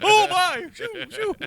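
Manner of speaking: high-pitched flustered droid; hammed-up panicky droid voice; Three P O. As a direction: exclaim high, then shoo them away. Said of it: "Three P O tells us to shoo".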